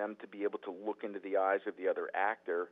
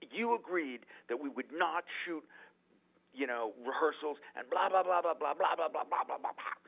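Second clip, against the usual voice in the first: high, mock furious rant; half-hammed angry tirade, pitched high; retold screaming; 7 semitones higher than usual. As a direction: rattle it off like an angry star yelling. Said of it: "he starts screaming at me".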